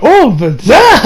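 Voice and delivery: swooning. Retold or imitated. Reviewer gushing over Videl, high slow rising swoon.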